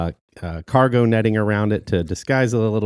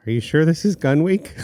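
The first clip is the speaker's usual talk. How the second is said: put-on kid's question; 9 semitones higher, 3 dB louder than usual. Son's doubtful question, quoted.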